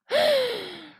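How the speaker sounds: high, audible gasp; gasping inhale. Real, not acted. Teacher over-accentuating the breath, with a high gasp. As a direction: draw breath in sharply and audibly. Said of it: "It sounds like I'm gasping".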